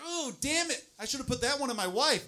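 high, rueful squeal; regretful squeal. Retold or imitated. Man regretting a wasted load, high rueful squeal. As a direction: wince high at the missed chance. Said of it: "I was like".

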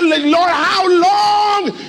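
high, slow shouted cry; shouting. Acted. Preacher crying out to the congregation, shouting. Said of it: "Why are you shouting already".

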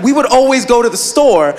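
ordinary talk, nothing marked

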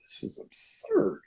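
breathy high whisper of disbelief; hushed and far off, as if to yourself; incredulous inner voice. Retold or imitated. Thinking it over, a whispered high scoff. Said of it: "it's like".

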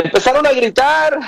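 pitched well up for emphasis; emphatic high-pitched repeat. Real, not acted. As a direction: lift the voice high to evoke shouting. Repeats it raised, evoking the shouting.

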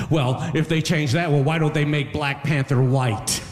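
high, slow put-on objection; mock arguer. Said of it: "they hit me with the argument".

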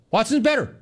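same claim squeaked up high; high-pitched mock announcement. Host mimicking a coach's unlikely announcement, high-pitched mockery. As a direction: repeat it high, as an absurd quote.

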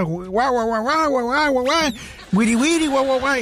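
high yapping bark for a ranting woman; mock dog barking. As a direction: yap like a little angry dog. Woman yelling at him, as barking.

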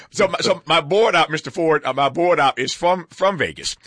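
ordinary talk, nothing marked

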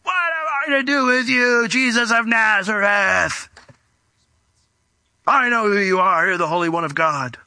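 long, drawn-out wail that falls away; possessed man's outcry. Acted. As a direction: wail it slowly like a man possessed. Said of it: "all of a sudden this guy goes".

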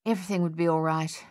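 usual speaking voice

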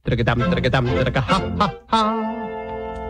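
tongued fanfare breaking into ha-ha notes; low tattoo jumping up to a gleeful ha; happy trumpet imitation. Storyteller shows the trumpet happy, fanfare turning to laughter. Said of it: "And the trumpet is happy".

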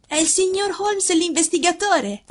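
high-pitched youthful question; boy's voice, high throughout; young boy. A narrator's reading. Young son questions father.

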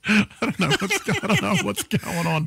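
laughing. Real, not acted. Host laughing at own croaky voice.

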